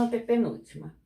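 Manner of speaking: ordinary delivery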